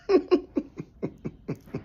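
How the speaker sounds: a sudden high cackle of laughter. Real, not acted. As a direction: cracks up at his own joke. Bursts out laughing at own joke.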